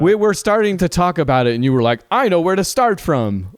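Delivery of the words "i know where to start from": high, drawn-out confident declaration; mock-authoritative, deliberately paced. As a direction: imitate someone announcing it with total authority. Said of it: "like, authoritatively".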